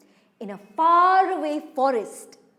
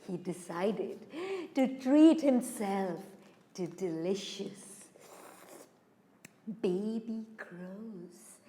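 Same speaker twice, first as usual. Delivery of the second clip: low, hushed narration with long pauses; ominous storytelling; 7 semitones lower, 9 dB quieter than usual. A narrator's reading. Storyteller revealing the hidden snake, low ominous hush. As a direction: drop low and soft, drawing out the menace.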